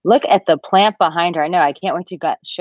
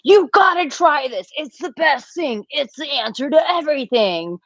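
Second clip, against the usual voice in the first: much higher, over-eager mock gush; gushing enthusiasm; 9 semitones higher than usual. Host imitating her over-eager self pushing a new healing method, gushing. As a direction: send yourself up as the hyped convert. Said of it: "I'm like".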